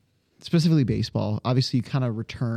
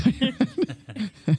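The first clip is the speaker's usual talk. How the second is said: laughing through the question; 11 semitones higher, much slower than usual. Real, not acted. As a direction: ask it while cracking up. Cracking up over nickname.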